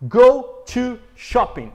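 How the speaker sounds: slow, loud "Go to shopping"; teacher presenting the common mistake slowly; slow demo. Shown as a demonstration. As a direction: set out the error slowly. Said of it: "esse aqui é o último".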